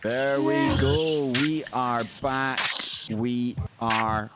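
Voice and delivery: slow, drawn-out on-air announcement; show announcer. Real, not acted. Host telling the audience the show is back, drawn-out announcing. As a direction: stretch each word out to open the broadcast.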